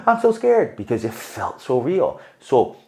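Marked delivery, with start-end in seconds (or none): 0.1-0.8 mock scared. Mock fright at horror movie.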